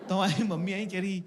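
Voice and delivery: ordinary delivery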